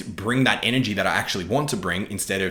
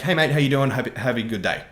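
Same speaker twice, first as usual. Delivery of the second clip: higher and louder hearty hello that settles by the end; friendly mate greeting; 5 semitones higher, 4 dB louder than usual. Retold or imitated. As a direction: greet a passing bloke warmly and loudly. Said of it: "It's like".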